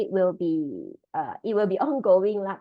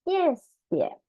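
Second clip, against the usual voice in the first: ordinary talk, nothing marked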